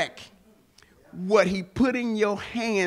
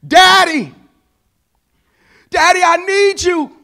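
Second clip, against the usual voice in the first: high, loud panicked cry of a daughter; frightened child calling her father; desperate plea; 11 semitones higher, 10 dB louder than usual. Retold or imitated. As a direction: call out for daddy in real alarm. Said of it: "I hear a desperate".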